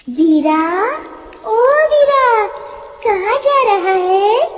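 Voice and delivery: luring witch call. Acted. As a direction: call him sweetly from afar. Witch calls out to Veera. (ऊँची, लंबी खिंची पुकार)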